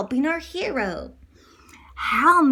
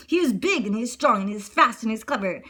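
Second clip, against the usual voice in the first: plain reading voice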